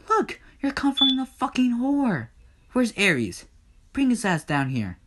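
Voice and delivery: high-pitched acted exasperation; indignant outburst. Hades scolds gods comforting Persephone, exasperated outburst.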